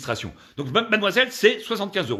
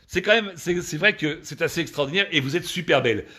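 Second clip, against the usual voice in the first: ordinary talk, nothing marked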